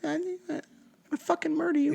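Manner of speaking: high, soft tiny angry voice; tiny fairy voice. Pretend. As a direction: squeak out a tiny threat. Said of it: "You gotta go higher pitch 'cause you're barely hearing".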